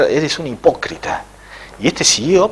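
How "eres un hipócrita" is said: plain reading voice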